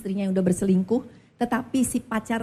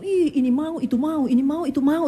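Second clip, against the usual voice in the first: confused husband; 6 semitones higher than usual. Retold or imitated. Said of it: "nah si bapak bingung".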